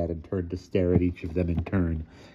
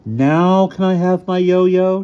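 put-on high, loud, drawn-out child voice; small boy's plea; 12 semitones higher, 8 dB louder, slower than usual. A narrator's reading. Tycho wants his yo-yo back, high pleading voice. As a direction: plead like an impatient kid.

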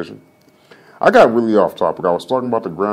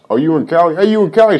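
high, loud mock-eager greeting building up; mock excited greeting; 8 semitones higher, 4 dB louder, faster than usual. Streamer mimicking people excited to meet someone from Cali, loud and high. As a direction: play the over-eager stranger asking about Cali. Mocking how people ask where you're from.

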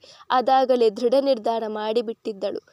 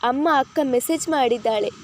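plain reading voice

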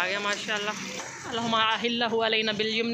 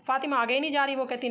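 ordinary talk

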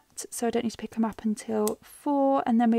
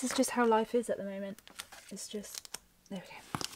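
whispering; 6 dB quieter than usual. Real, not acted. Whispering while fixing tape.

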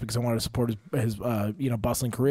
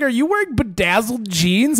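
higher, louder mock jab; mocking retort; 9 semitones higher, 6 dB louder than usual. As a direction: toss the insult back loudly. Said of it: "I was like".

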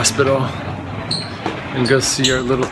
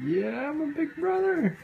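put-on high-pitched playful voice for a boy; proud big brother; 12 semitones higher, 4 dB quieter than usual. Relative speaking for the new big brother, high playful pride. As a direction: voice the little boy's pride, high and drawn up. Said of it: "He's just like".

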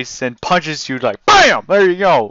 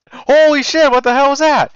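startled cry, much higher and louder; shocked; 11 semitones higher, 5 dB louder than usual. Real, not acted. Gamer startled by the boss's homing lasers, shocked cry. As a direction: caught off guard by a sudden attack. Boss attack surprises him.

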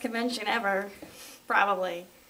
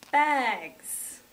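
normal talking voice

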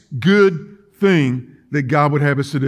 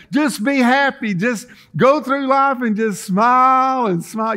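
high, grinning happy-preacher voice; mock cheerfulness through a permanent smile; hammed-up smiling impression, pitched high; Gomer Pyle; 6 semitones higher than usual. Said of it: "this is what they say".

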